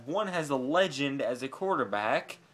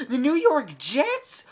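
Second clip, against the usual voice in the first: higher, louder, slow disbelief; louder incredulous; 8 semitones higher, 6 dB louder, slower than usual. Real, not acted. Fan repeating the Jets rumour, louder and higher.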